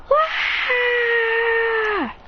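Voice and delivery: high, sudden scream of alarm; girl's scream. Acted. Girl screams on finding a boy collapsed, high alarmed cry. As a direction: let out a sharp startled cry.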